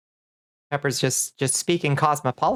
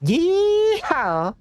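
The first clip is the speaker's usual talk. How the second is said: Southern yeehaw. Pretend. Streamer playing cowboy for chat, in a bad Southern accent. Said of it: "the worst Southern accent I've ever heard".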